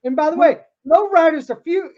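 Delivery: ordinary talk, nothing marked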